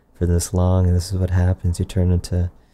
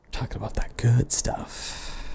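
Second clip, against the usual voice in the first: whispering; 3 semitones higher, 7 dB quieter than usual. Acted. Hushed whisper about armor.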